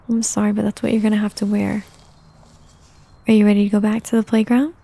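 normal talking voice

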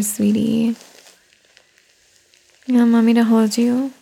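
normal talking voice

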